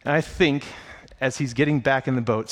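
normal talking voice

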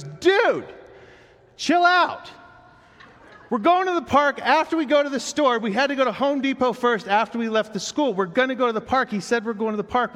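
put-on high, loud kid voice scolding his friend; hammed-up child telling a friend to calm down; bossy little boy. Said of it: "And he goes".

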